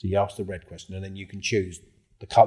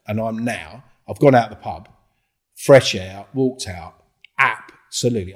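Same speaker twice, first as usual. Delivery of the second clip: ordinary talk, nothing marked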